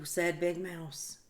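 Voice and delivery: ordinary delivery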